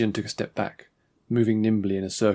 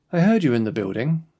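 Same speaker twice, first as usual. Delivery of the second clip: put-on higher, louder clipped upper-crust lord's accent; clipped, cold accent; 5 semitones higher, 6 dB louder than usual. A narrator's reading. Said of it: "his clipped accent precise and cold".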